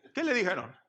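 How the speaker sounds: ordinary delivery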